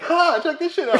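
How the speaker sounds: very high, loud gleeful firefighter. Retold or imitated. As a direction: crow over the find loudly. Firefighter quoted.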